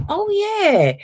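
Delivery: as if the penny just dropped; surprised realisation. Retold or imitated. Recalling noticing her own changes, high surprised oh yeah. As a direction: pitch jumps high on a sudden oh yeah. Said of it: "and then I was like".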